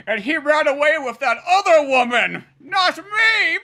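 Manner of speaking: high, loud mock sobbing wail; scorned woman. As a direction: wail like a jilted lover.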